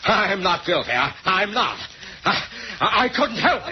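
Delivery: high, rising panic; a frightened sailor pleading he couldn't help it; desperate pleading. Acted. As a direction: beg them to believe you. Sailor pleads his innocence.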